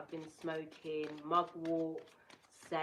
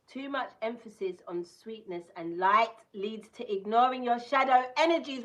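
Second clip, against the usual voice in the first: louder, building higher toward the end; card reading voice; 7 semitones higher, 13 dB louder than usual. Acted. Reading the card's warning to viewers, proclaiming.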